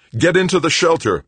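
loud, high urgent command; yelled order. A narrator's reading. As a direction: yell it as an emergency order. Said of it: "Paul yelled".

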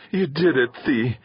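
high-pitched, a slight tremble; moved mother, voice just wavering; slightly trembling. A narrator's reading. Mother praising Theo for the repair, slightly trembling. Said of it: "her voice trembling slightly".

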